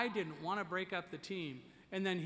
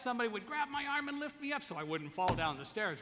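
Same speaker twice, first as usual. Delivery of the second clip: ordinary talk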